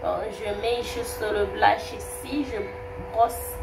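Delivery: ordinary talk, nothing marked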